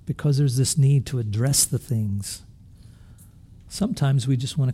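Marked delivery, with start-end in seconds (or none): none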